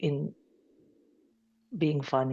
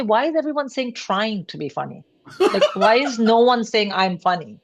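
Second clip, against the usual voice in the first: mock-indignant complaint, pitched up; indignant protest; 5 semitones higher than usual. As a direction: protest it with comic exasperation, stretching the second question. Retelling her own indignant protest.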